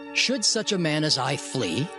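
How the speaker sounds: ordinary talk, nothing marked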